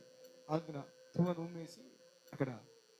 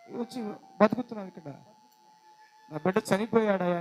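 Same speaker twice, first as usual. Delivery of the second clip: loud crying plea to God; preacher voicing a mother's loud grieving cry; loud wailing lament; 4 semitones higher, 14 dB louder than usual. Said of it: "పెద్దగా ఏడుస్తుంది".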